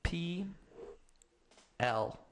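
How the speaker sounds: slow letter-by-letter spelling. Real, not acted. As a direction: spell each letter with pauses. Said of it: "All right, platypus".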